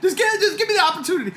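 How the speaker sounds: high, laughing, begging to do it for free; laughing plea. Real, not acted. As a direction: laugh through the offer, pleading. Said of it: "laughs heard".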